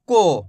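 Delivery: को said low and firm with o; model syllable ko. Shown as a demonstration. Teacher models को for students. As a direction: say को with a round o. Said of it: "ओ की मात्रा है तो कैसे पढ़ रहे".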